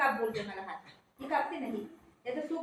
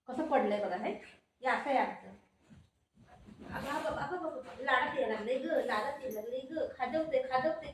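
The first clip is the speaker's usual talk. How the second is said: ordinary talk, nothing marked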